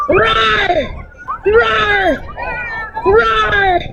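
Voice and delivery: high mock roar mid-chase; playful bear roar. Teacher roaring as a bear chasing the kids.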